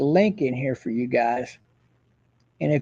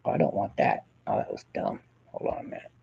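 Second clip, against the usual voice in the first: muttered aside; 11 dB quieter, faster than usual. Real, not acted. Muttering off-mic to self.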